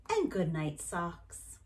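plain reading voice

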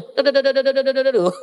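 high rapid-fire nonsense syllables; mock a nonstop stream of chatter; imitated wifely chatter. Wife answering husband's one word, mock rapid chatter. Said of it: "Apa jawaban dia?".

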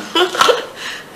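short amused laugh; a little chuckle at the teasing. Acted. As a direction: laugh it off lightly. Amused at being teased.

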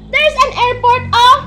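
high, loud pointing out; excited. Real, not acted. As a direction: call out the airport like a thrilling find.

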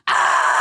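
a sudden high yelp of panic, then a laugh; inner panic scream. Retold or imitated. Friend staring her down, a high panicked yelp. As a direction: let out a shocked squeal. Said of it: "And internally, I was like".

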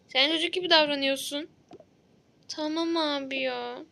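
usual speaking voice

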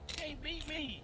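taunting. Real, not acted. Taunting rival over game.

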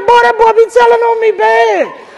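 dad outraged son told on him, high loud holler; exasperated shout. Retold or imitated. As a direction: holler it in disbelief. Complains about son telling.

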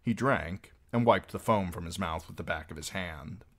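plain reading voice

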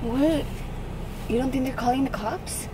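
ordinary talk

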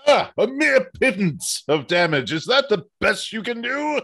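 loud, high mock outrage; hammed-up villainous scorn; theatrical taunt. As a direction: scoff grandly at a puny attack. In-character taunt after taking damage.

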